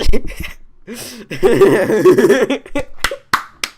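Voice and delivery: long loud high cackling laugh. Real, not acted. Cracking up at own joke, long loud laugh.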